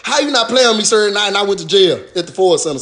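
ordinary talk

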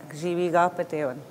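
Gijibigapateon rattled off quickly in one smooth run; said fast. Shown as a demonstration. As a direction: run through Gijibigapateon fast, without breaking it up. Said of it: "you say it better when you say it fast".

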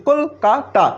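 "Kolkata" counted out as three beats; syllable by syllable. Shown as a demonstration. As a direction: separate কল, কা, তা for the learner. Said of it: "তিনবারে উচ্চারিত হচ্ছে".

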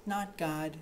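ordinary talk, nothing marked

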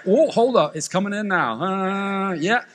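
hammed-up message coming in from God; mock prophecy. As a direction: pretend prophet receiving a word. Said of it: "I said that wrong".

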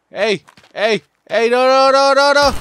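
high, loud string of no's; frantic loud protest; panicked shout. Real, not acted. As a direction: yell in a panic. Panicking as enemies attack.

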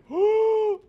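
a loud, high, startled gasp; sharp high gasp. Real, not acted. Streamer watching the level fail, high gasp. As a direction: gasp as if it all went wrong.

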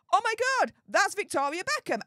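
high-pitched mock fangirl excitement; thrilled squeal, imitated. Friend retelling how she spotted [name], imitated thrilled excitement. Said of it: "so thrilled and with excitement".

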